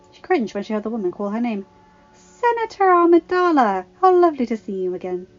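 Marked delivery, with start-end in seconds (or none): 2.1-5.3 fake sweet greeting. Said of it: "Kyla said".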